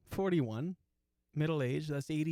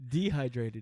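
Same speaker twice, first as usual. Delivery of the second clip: usual speaking voice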